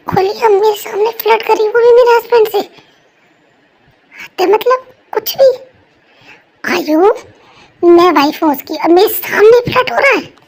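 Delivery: wife fumes at friend flirting with her husband, loud; jealous outburst. Acted. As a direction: explode with jealousy.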